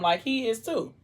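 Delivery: ordinary delivery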